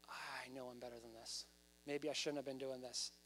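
low, soft admission of shame; subdued, downcast inner voice; ashamed. Acted. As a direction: confess it quietly to yourself. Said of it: "Maybe he felt shame".

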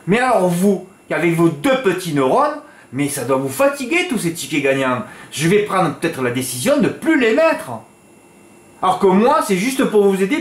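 exasperated scolding. Real, not acted. Tipster scolding his followers over their small bets, exasperated. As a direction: talk down to them, fed up.

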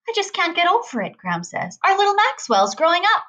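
high, fond gushing; Graham gushing that her boy is growing up; gushing mother. A narrator's reading. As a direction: coo over him like a proud mother. Graham gushing over grown son.

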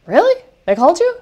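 high, loud, incredulous "really?"; the speaker, stunned a junior got the call first, disbelieving; shocked disbelief. Retold or imitated. Speaker stunned by Marla's news, high incredulous surprise. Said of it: "And I'm like".

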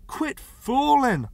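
high, loud, slow plea; drawn-out, urgent pleading. A narrator's reading. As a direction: plead to be let in. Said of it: "Parker pleaded".

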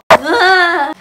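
short loud high shriek; excited scream. Real, not acted. Vlogger overcome by the parcel, excited shriek. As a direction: burst out with an excited yelp.

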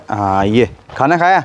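normal talking voice

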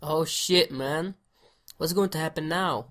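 ordinary talk, nothing marked

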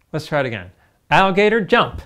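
ordinary delivery

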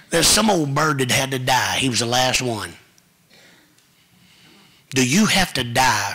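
loud, climbing sermon delivery; full-force preaching. Real, not acted. Preacher rousing the congregation to surrender, loud and climbing. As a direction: shout it to the pews like a revival preacher.

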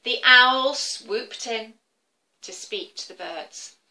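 ordinary delivery

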